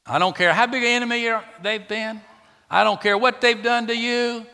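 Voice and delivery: high, loud, insistent cry; fervent rising protest; impassioned outburst. Real, not acted. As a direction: plead it, pushing up high. Preacher's sudden impassioned outburst.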